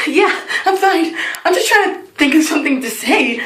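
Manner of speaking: high, strained pretence of being fine; mock freaking out. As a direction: insist you're okay in comic panic. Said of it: "I'm freaking out".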